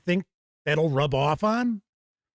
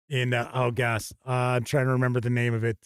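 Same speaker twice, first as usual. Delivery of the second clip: ordinary talk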